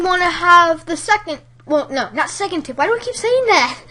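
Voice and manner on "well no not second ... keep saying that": normal talking voice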